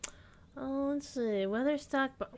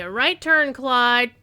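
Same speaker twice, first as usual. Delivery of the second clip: shouting; 3 semitones higher, 11 dB louder, much slower than usual. Acted. Shouted movie line callout.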